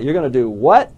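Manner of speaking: ordinary talk, nothing marked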